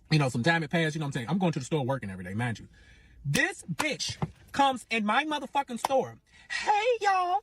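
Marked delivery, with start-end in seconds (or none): 3.2-6.2 slow, high-pitched outrage; indignant storytelling. Real, not acted. Rival walks into her store. 6.5-7.4 very high, put-on sweet greeting; mocking rival's greeting.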